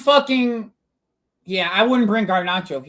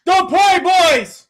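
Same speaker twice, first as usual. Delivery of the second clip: excited praise; 9 semitones higher, 7 dB louder, much slower than usual. Real, not acted. Praising team after goal.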